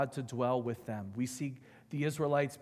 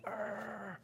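angry growl; 8 semitones higher, 8 dB quieter than usual. Retold or imitated. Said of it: "'cause I would get angry".

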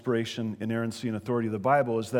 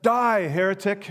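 mock fierce cry; 7 semitones higher, 5 dB louder than usual. Punchline of retold joke.

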